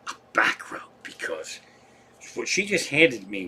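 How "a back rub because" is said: whispered aside. Real, not acted. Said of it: "aside about wife".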